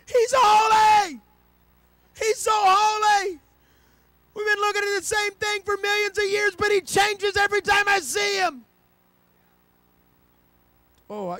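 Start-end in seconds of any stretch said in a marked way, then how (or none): 0.0-8.7 high, loud, excited shouting; an angel who can't believe what he sees; one angel yelling wonder to another; awed angel shouting. Acted. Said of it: "Another one's like".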